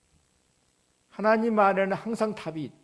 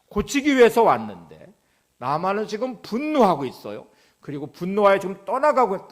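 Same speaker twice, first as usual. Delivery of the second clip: raised, louder and higher pulpit voice; impassioned preaching; 5 semitones higher, 10 dB louder than usual. Real, not acted. Preacher retells Naaman walking away from healing, impassioned preaching. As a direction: press the point on the congregation with force.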